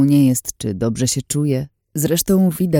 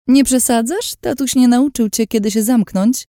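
high-pitched sneering put-down; snide; 10 semitones higher, 4 dB louder than usual. Acted. Lin tells the nosy girl to shut up, scornful. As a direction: cut her off with haughty scorn. Lin snubs the narrator's questions.

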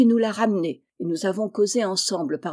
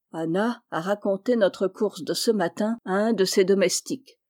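ordinary delivery, nothing marked

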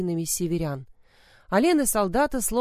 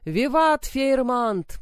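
high, slow, loud chant; soldiers' battle cry; 6 semitones higher, 4 dB louder, slower than usual. A narrator's reading. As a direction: cheer it like a war cry. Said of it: "подхватили солдаты".

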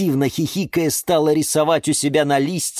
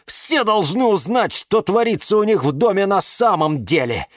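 plain reading voice

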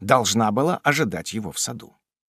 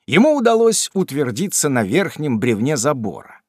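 ordinary delivery

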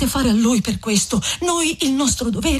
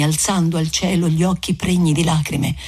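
ordinary delivery

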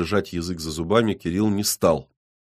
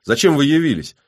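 louder, higher, indignant challenge; indignant flare-up; 7 semitones higher, 6 dB louder than usual. A narrator's reading. Woman confronting the policeman who turned up, indignant. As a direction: challenge the unwelcome visitor. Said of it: "взвилась Иола".

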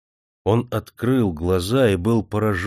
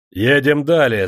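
louder shouted order; shouting; 4 semitones higher, 6 dB louder, slower than usual. A narrator's reading. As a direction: shout at the servants. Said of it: "закричал слугам Никита".

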